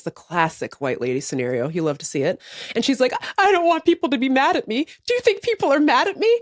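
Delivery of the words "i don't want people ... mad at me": high, quick anxious whine, lightly imitated; mock tearful worry. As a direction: imitate a needy plea for reassurance. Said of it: "And then she burst into tears".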